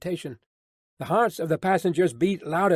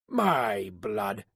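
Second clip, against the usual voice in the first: low vexed oath from a coachman; mutedly vexed, pitched well down; vexed exclamation; 7 semitones lower than usual. A narrator's reading. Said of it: "ejaculated the vexed coachman".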